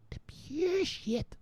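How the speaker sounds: mock high drawl. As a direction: draw it out high like a blissed-out junkie. Savouring the drug, repeated slowly.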